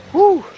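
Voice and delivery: high, loud whew; sharp whew. Real, not acted. Vlogger with a cold hand, sharp whew. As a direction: let out a sudden high whew.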